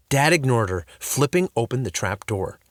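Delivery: ordinary delivery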